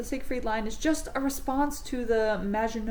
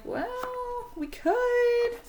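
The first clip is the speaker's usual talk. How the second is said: much higher, hammed-up evasive voice for the timid allies; high mock dodge; 11 semitones higher, slower than usual. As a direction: play the allies squeaking out a cowardly excuse. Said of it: "Britain and France is like".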